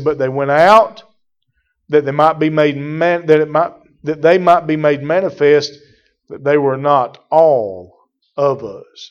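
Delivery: loud, driving pulpit preaching; full-force preaching. Acted. Preacher pressing the point on his congregation, loud and driving.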